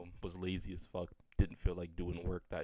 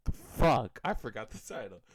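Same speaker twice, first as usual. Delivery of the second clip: loud, higher burst of swearing; frustrated outburst; 4 semitones higher, 9 dB louder than usual. Real, not acted. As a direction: blurt it out as you lose your thread. Forgets the title mid-intro.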